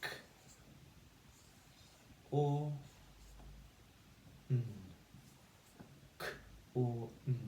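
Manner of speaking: segment "corn" sound by sound. Shown as a demonstration. Teacher segmenting corn for young readers. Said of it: "and we say".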